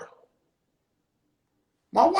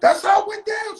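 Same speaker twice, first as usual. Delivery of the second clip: emphatic; 10 semitones higher, 4 dB louder than usual. Acted. Repeated with emphasis.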